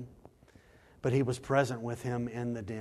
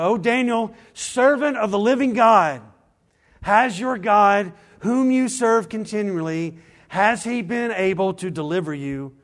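loud, high anguished call into the pit; an old king shouting down into the lions' den; 9 semitones higher, 12 dB louder than usual. Acted. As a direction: cry out to him, desperate for an answer. King calls anxiously into den.

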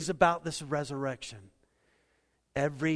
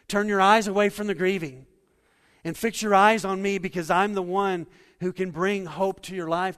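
louder, higher, urgent pulpit voice; impassioned preaching as Jesus; 4 semitones higher, 8 dB louder than usual. Acted. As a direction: plead with her to look to him. Jesus voiced to grieving Martha.

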